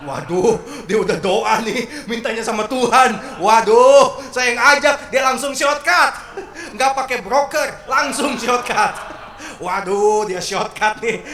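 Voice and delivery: loud laughing alarm at her bold prayer; laughing panic. Real, not acted. Father panicking as daughter prays straight to God, loud laughing alarm. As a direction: blurt it out, half laughing, half panicking.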